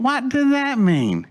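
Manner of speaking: high, slow, baffled question; incredulous. Retold or imitated. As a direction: re-enact total bewilderment, stretching the words. Retold bafflement at cryptic message.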